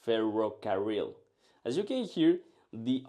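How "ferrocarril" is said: English speaker accent. Shown as a demonstration. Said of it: "English speakers roll their R's like this".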